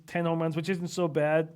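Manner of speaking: normal talking voice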